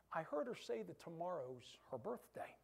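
secretive whisper, no voice. Retold or imitated. Campola whispers the plan to the diner owner. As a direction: lean in and let him in on a secret.

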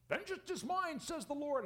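high, sarcastic scripture quote; mock people playing God; squeaky sarcastic vengeance quote. Mocking self-appointed avenger.

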